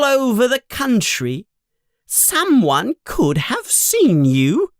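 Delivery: high voice climbing as she scolds; mother's rising scold. Acted. Mother complaining about the stolen flying car, rising and high. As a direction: let the telling-off build.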